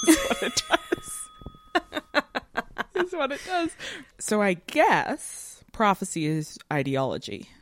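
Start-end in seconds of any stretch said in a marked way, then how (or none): 0.0-3.7 high, breathy laugh through the words. Real, not acted. Podcaster laughing at how prophecy fulfils itself. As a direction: laugh it out in disbelief.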